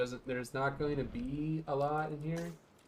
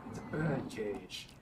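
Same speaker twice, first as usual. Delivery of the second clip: low, slow, soft hint of Sam Neill; a lightly done Sam Neill line, hushed; Sam Neill impression; 3 semitones lower, 5 dB quieter, slower than usual. Gamer quotes the dinosaur movie as Sam Neill, low and slow. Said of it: "That's my Sam Neill impression".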